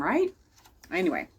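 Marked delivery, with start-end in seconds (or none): 0.0-0.3 put-on higher, rising kid's right; child seeking agreement, rising; high questioning right. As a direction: toss it up like a wide-eyed kid. Child's thought about teacher.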